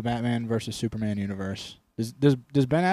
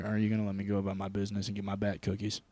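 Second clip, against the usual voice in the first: low Batman menace; 5 semitones lower, 9 dB quieter, much faster than usual. Retold or imitated. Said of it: "Do we have an actual problem here?".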